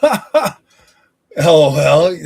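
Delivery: spelling out LOL through a loud laugh. Real, not acted. Streamer laughing at a chat comment, loud laugh.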